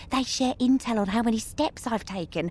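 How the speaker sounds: usual speaking voice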